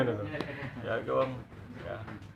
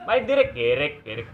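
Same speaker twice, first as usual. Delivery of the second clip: Indramayu dialect; 7 semitones higher, 7 dB louder than usual. Retold or imitated. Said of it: "bahasa kiri ke orang hilang".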